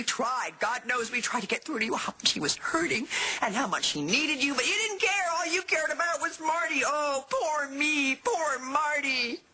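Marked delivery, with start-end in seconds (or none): 4.5-9.4 accusing, rising into drawn-out mock pity; mocking reproach. Confronting a neglectful parent, accusing then mocking. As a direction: throw their self-pity back at them, higher and slower.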